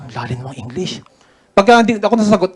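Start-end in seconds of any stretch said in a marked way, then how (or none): 0.0-1.0 soft, low aside to himself; quiet amazed mutter about the deep English. Retold or imitated. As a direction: mutter it under your breath, impressed. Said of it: "sabi ko".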